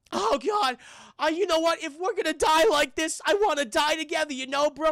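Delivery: high, loud, whiny mock desperation; hammed-up action-star heartbreak; melodramatic earnest plea. Mark wants to die together, high desperate melodrama. Acts Wahlberg's dramatic plea.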